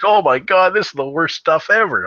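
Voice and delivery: loud, high outcry of disgust; retold disgusted shock. Buddies tasting sweet tea for the first time, loud disgusted outcry. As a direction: recoil from the taste out loud. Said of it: "They're like".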